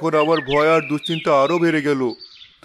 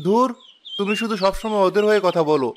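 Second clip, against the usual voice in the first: ordinary talk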